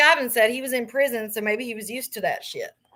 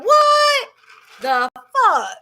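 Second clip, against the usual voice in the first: much higher, louder, what stretched out; retold outrage, pitched way up and loud; shocked outburst; 11 semitones higher, 8 dB louder, much slower than usual. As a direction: yelp it in stunned disbelief. Retold reaction to shocking offer.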